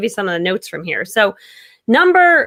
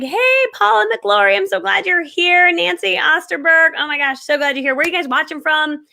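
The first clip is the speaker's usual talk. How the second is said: warm welcome; 6 semitones higher than usual. Shown as a demonstration. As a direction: greet each arriving viewer with delight. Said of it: "welcome everyone who joins".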